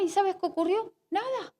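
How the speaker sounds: usual speaking voice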